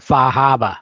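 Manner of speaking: Boston-style Far Harbor, no r sounds; New England accent. Shown as a demonstration. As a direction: drop the r's: Fah Hahbah. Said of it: "you weren't saying it with the correct New England accent".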